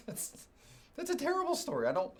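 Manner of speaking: high-pitched laughing disbelief. Real, not acted. Preacher laughing at his own story to the congregation, cracking up high. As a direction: laugh through it, voice jumping high.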